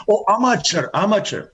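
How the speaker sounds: ordinary delivery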